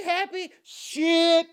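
high and loud whoop of delight; ecstatic shout. Real, not acted. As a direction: blast it out in joy. Overjoyed reaction.

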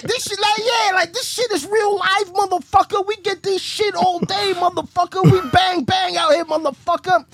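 a biker angrily scolding the teller; pissed off. Retold or imitated. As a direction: rant it at him, offended. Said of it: "This motherfucker got pissed".

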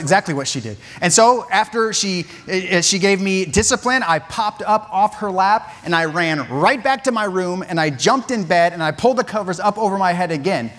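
ordinary delivery

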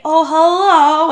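high, drawn-out innocent greeting; mock meek. Drunk woman greeting the police, mock-meek and sweet. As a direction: imitate someone suddenly meek as a lamb. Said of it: "she's meek as a lamb".

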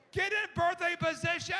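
loud commanding call; commanding shout. Retold or imitated. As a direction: order the room like a drill leader. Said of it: "she goes".

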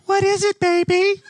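a boy squeaking out a sweet wife impression; son faking mom. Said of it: "he goes".

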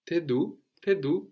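T'es d'où said as te du; high, casual contracted question; double contraction. Shown as a demonstration. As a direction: run both contractions together. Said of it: "a contraction of tu and e".

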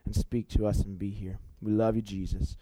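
quiet, intimate opening prayer; soft prayer. Acted. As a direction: pray softly to Jesus before preaching. Opening prayer before the sermon.

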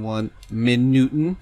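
"minute" said slowly as "min-newt-en"; playful mispronunciation. Real, not acted. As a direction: stretch "minute" into mock-German "min-newt-en". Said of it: "It's a mini newt".

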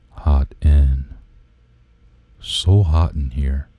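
low, slow, spaced-out murmur; ASMR voice. Shown as a demonstration. Host demonstrating his podcast's middle, low slow ASMR. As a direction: drop low and linger between phrases. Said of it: "it'll be like".

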